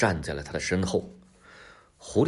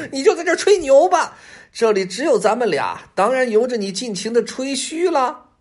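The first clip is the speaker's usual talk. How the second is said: very high, loud laughing taunt; laugh, then jeer at the tiger's boasting; mocking fox; 7 dB louder than usual. A narrator's reading. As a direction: derisive high-pitched fox. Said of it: "还敢取笑他吹牛".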